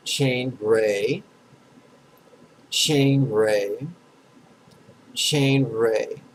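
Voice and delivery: "Chain ray" as two long, separate syllables; syllable stacking. Shown as a demonstration. Teacher stacks two syllables for students, pronunciation demo. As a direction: stretch "chain ray" evenly, pausing for an echo. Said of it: "Two syllables".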